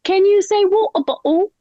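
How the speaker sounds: high-pitched mock gushing over an accent; excited Canadian locals. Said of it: "everyone's like".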